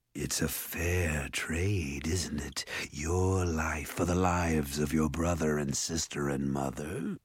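low, soft, slow coaxing; soothing ogre. A narrator's reading. Ogre bargaining for Jared's life, sinister soothing. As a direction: coax gently in a hushed monster voice. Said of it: "his voice became soothing".

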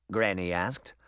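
usual speaking voice

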